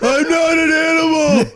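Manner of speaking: high, loud hammed-up plea; Elephant Man parody. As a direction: wail it like a tragic misfit.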